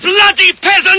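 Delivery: high exasperated snarl; angry outburst. Acted. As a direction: spit out the insult. King's insult.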